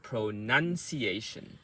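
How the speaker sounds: pronunciation slowed, NUN held and stressed; slow practice take. Shown as a demonstration. Coach contrasting practice with quick speech, pronunciation slow. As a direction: say pronunciation slowly, part by part. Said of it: "So when you say quickly".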